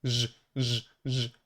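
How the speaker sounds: "Z" as short buzzing zh sounds; voiced zh sound. Shown as a demonstration. Teacher testing the dotted Z, voiced buzz. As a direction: buzz "z" like zh from the throat. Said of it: "I use my throat to produce the sound".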